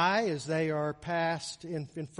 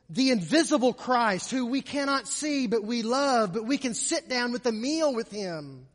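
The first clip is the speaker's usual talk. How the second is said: raised, higher preaching voice; impassioned preaching; 6 semitones higher, 6 dB louder than usual. Real, not acted. Preacher describing the meal with the invisible Christ, impassioned preaching. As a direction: drive it home with mounting fervour.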